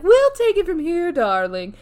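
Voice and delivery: high, loud start dropping on darling; imitating the lead Muse. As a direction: take over grandly, as the Muse would. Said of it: "'cause she's like".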